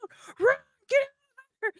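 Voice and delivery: high, frantic cry for her to run; panicked yell. Retold or imitated. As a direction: shriek it in panic at the screen. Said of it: "I was like".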